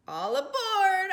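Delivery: high, loud call. A narrator's reading. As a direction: call everyone aboard. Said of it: "Oscar called".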